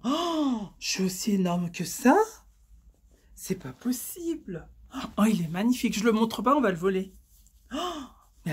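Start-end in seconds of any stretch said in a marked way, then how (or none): 3.3-4.7 softer, slow whispered disbelief; dismayed whisper. Real, not acted. Trying on shorts that feel too big, dismayed whisper. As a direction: breathe it out in dismay. Shocked at her own size.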